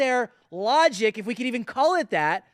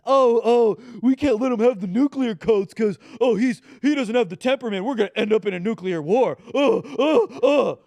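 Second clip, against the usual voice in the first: louder, fast, panicky whine; mocking hysterical liberal; 5 dB louder than usual. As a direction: ham up the alarmed opponents. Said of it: "Super outdated attack against President Trump".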